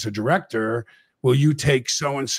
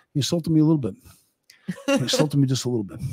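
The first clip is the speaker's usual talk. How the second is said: lightly done Goodfellas tough-guy delivery, a bit higher; playful hint of a Goodfellas mobster; Goodfellas line; 4 semitones higher, faster than usual. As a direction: quote the mobster line with a mock-wounded edge. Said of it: "I stole his line from, uh, Goodfellas".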